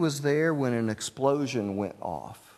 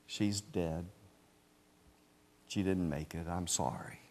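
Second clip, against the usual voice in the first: much softer, lower, solemn news; 5 semitones lower, 9 dB quieter than usual. Retold or imitated. Doctor tells waiting men the girl died, soft and solemn. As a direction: break the news gently. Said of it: "said solemnly".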